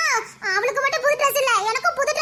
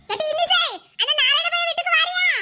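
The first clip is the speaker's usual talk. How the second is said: raised calling voice, higher and louder; 6 semitones higher, 3 dB louder than usual. Acted. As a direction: call her from the next room. Calling a child across house. (உரத்த குரலில் கூப்பிடுதல்)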